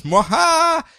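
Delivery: high theatrical evil laugh; mustache twirling villain laugh. Retold or imitated. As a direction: cackle like a cartoon baddie.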